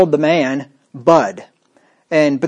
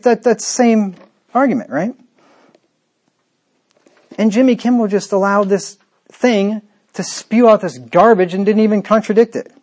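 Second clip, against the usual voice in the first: normal talking voice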